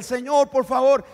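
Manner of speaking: loud, high pleading cry; woman shouting her plea at Jesus behind him. Acted. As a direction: call after him begging. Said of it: "la mujer gritando detrás de él".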